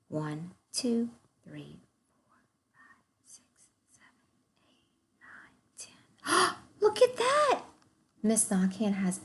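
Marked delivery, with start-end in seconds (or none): 0.1-6.6 fading count to a whisper. Shown as a demonstration. 6.7-7.6 gasp, then a high amazed exclamation; mock amazed gasp.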